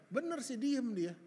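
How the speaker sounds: ordinary talk, nothing marked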